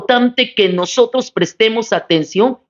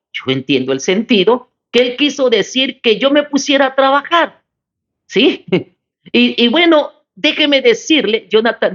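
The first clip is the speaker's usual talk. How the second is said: ordinary talk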